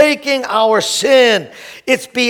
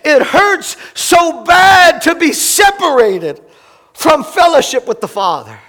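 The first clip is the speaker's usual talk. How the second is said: anguished cry; 4 semitones higher, 4 dB louder than usual. Acted. Pastor voicing Jesus forsaken, anguished cry. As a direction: cry out the separation. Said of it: "He says".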